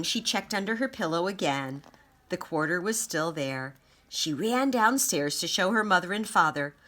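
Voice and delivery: usual speaking voice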